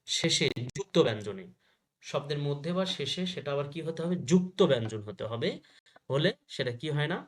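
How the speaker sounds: ordinary talk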